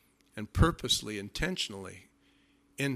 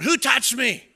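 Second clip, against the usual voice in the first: higher, louder call out; Jesus calling out; 9 semitones higher, 8 dB louder, slower than usual. Retold or imitated. Jesus calling to the pressing crowd, loud call. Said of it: "Jesus yells out".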